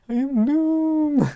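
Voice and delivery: a playful mock villain boast; hammed-up villain line. Doombot embracing its duality to Chase, hammed-up grandeur. Doombot after laugh, repeats claim.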